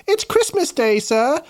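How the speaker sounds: a mock British kid, pitched high; hammed-up British schoolboy cheer; playful British accent on a Christmas greeting. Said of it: "with a British accent".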